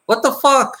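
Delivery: high-pitched mock outburst of disbelief; acted disbelief. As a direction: blurt it out high, as if just realizing. Acts out a sudden realization moment.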